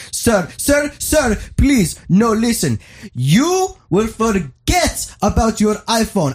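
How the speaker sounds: very high, slow, hammed-up Indian accent; pushy Indian salesman. Salesman pushes the free Samsung, in a mock Indian accent. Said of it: "He goes".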